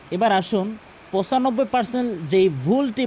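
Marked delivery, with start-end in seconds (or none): none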